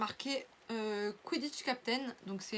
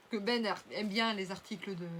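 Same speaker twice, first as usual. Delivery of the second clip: normal talking voice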